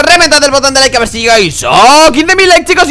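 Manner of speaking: normal talking voice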